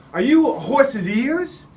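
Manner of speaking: high, loud, drawn-out showman question; head clown. Acted. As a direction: set up the gag for the whole tent. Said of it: "He said".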